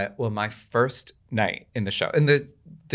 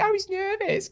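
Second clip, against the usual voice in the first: much higher, light sing-song thought; innocent naive page; slower than usual. Retold or imitated. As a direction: think it with naive cheer. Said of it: "and I'm just thinking".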